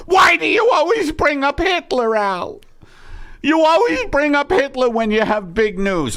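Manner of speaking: the exasperated wife yelling back; Margaret voice. Acted. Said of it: "two voices that Eddie Pepitone channels".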